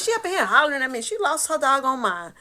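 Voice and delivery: high, loud exasperated protest; indignant complaint. Retold or imitated. Telling family sister lost her mind, loud. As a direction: fuss about her loudly.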